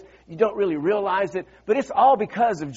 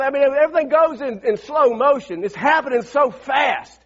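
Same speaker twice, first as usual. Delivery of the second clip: usual speaking voice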